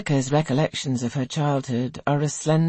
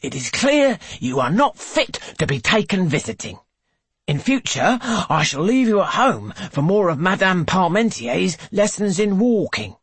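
louder, haughty scolding matron; a strict governess scolding; stern matron; 7 semitones higher, 4 dB louder than usual. A narrator's reading. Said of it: "says Missus Chapel".

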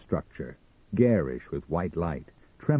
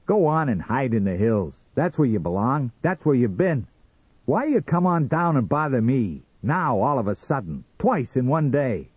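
raised, higher-pitched complaint; irritated scolding; 6 semitones higher, 4 dB louder than usual. Acted. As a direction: chase off an unwelcome visitor. Sam orders Martian away.

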